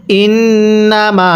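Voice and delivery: ইন্নামা with the final মা drawn out very long; slow chant, held n and long last vowel in ইন্নামা; three-alif stretch. Shown as a demonstration. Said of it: "তিন আলিফ টেনে পড়তে হয়".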